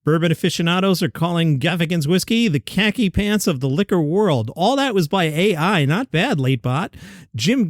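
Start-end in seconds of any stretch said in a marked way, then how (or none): none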